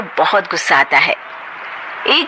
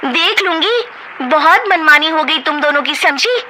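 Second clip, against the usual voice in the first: heated quarrel; 9 semitones higher than usual. Acted. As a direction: snap back and forth. Sisters-in-law quarrel.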